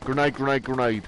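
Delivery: urgent, yelled warning; shouting. Real, not acted. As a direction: call it out loud and high. Warning teammate of a grenade.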